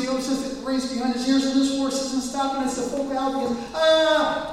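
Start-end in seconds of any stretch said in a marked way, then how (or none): 3.7-4.5 high loud cry of terror; panicked scream. Retold or imitated. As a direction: scream at the cliff edge. Horse racing to the cliff.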